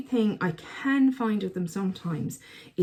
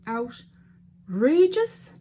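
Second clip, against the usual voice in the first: drawn-out outrageous; 5 semitones higher, 5 dB louder, much slower than usual. Real, not acted. Reacting to the swatches.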